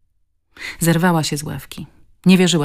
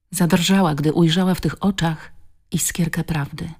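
ordinary delivery

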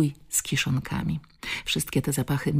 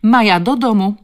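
put-on high, loud call ordering a child home; krzyk babci; 7 semitones higher, 10 dB louder than usual. A narrator's reading. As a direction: shout it sharply as a cross grandmother. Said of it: "krzyknęła". (ostry, głośny okrzyk)

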